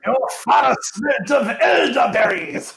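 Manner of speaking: high, drawn-out insult in a hammed-up French accent; mock French taunt. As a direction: jeer like an outrageous French knight, accent laid on thick. Film taunt after French accent joke.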